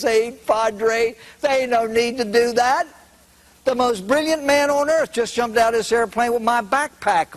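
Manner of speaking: put-on a drawling stoner kid, amused; hippie voice. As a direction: slacker hippie cracking the punchline. Hippie voice in joke punchline.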